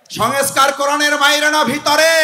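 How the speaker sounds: high, loud call to the crowd. Real, not acted. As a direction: fire the question out to demand an answer. Repeats question louder to crowd.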